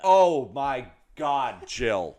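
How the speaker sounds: very loud, slow outraged gasp; shocked. Real, not acted. Co-host outraged at a dance mom's remark, loud and slow. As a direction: drag out the shock.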